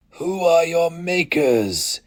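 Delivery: high, slow, searching question; elder's ritual question; questioning Inkoroi elder. Acted. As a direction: ask it like a solemn rite.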